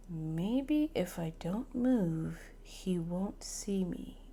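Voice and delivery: slow unvoiced whisper; soft, slow whisper to herself. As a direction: hide by whispering. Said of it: "I say to myself".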